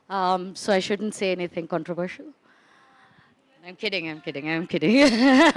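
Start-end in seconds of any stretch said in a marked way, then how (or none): 3.6-5.6 slowed words breaking into a laugh. Real, not acted. Speaker reassures the audience after a controversial joke, laughing. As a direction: say it through a real laugh.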